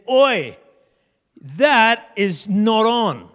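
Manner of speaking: loud, slow "Oi, that is not on"; mock indignant call. David calling out the giant, loud and slow. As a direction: call the giant out, drawn out and firm.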